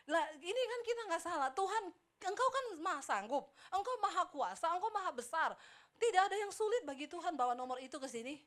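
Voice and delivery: high, loud, insistent prayer; acted plea to God. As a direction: plead upward in emphatic bursts, retelling a prayer. Retelling own bargaining prayer.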